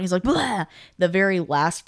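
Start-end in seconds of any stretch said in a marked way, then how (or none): 0.2-0.7 mock startled yelp. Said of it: "he freaks out".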